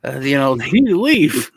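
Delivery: high, apologetic phone report; mock polite police call. Grandpa calls police about nice intruders, high polite voice. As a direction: report intruders as nicely as possible. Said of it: "it's like".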